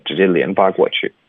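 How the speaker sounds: ordinary talk, nothing marked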